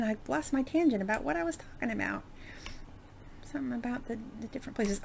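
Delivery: breathy self-muttered aside under the breath; whispered aside. Real, not acted. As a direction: whisper it, a little embarrassed. Losing her train of thought.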